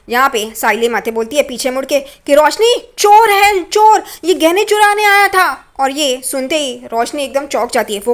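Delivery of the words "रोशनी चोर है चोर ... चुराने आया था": high, loud acted alarm; alarmed calling out. Saili turns to warn Roshni of a thief, acted alarm cry. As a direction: raise the alarm, stretching the words.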